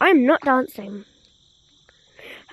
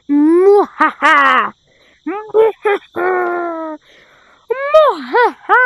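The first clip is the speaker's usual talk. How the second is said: slow hammed-up evil laugh; sneering panto villain; 3 dB louder, much slower than usual. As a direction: cackle like a cartoon villain.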